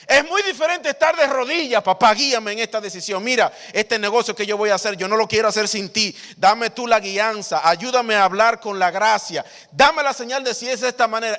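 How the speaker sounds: loud, high, intense preacher's plea; fervent preaching. Acted. Preacher acting out a heartfelt prayer to God, loud and high. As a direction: pour it out like a preacher begging God.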